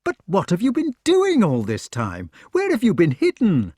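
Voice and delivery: astonished eager questions. Acted. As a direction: press him with amazed questions. Knight presses with questions.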